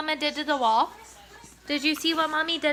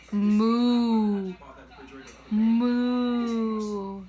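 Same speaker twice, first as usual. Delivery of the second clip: put-on mooing like a cow for the toddler; 3 semitones lower than usual. Shown as a demonstration. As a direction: show him the cow sound, low and drawn. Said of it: "Is that a cow?".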